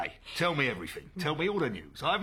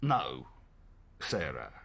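grave lowered reply; 10 semitones lower, 8 dB quieter than usual. Acted. Grave answer about politics.

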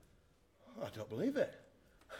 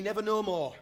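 usual speaking voice

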